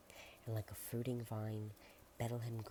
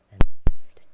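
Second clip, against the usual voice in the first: sudden loud burst; 28 dB louder than usual. Acted.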